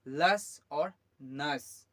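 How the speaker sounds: लस और नस said slowly, short a; correct endings. Shown as a demonstration. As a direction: slowly stress the short vowel in लस and नस. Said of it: "ना ये लेस है ना नेस है". (लस और नस धीरे, छोटे अ के साथ)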